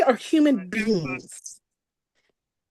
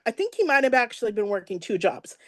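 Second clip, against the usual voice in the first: ordinary delivery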